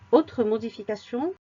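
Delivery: ordinary delivery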